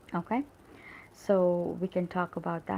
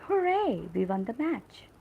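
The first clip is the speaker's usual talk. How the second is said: high, loud HOORAY, then settling down; joyful cheer; 5 semitones higher, 3 dB louder than usual. Shown as a demonstration. Teacher giving an example of an exclamatory sentence, joyful cheer. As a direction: demonstrate a burst of joy. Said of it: "which shows joy".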